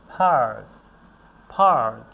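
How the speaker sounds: "পার্ক" loud, with a strong puff on the first sound; forceful aspirated P. Shown as a demonstration. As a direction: push air hard into the start of "পার্ক". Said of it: "pressure দিয়ে উচ্চারণ করি". ("পার্ক"-এর শুরুতে জোরে শ্বাসের চাপ)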